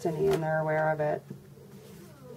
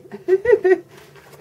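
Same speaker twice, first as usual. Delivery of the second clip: sudden high loud laugh; burst of high laughter; 12 dB louder than usual. Real, not acted. Reader laughing as extra cards fly from the deck, loud high laugh. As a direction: break out laughing at the mistake.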